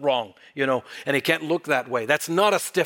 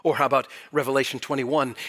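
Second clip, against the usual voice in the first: ordinary talk, nothing marked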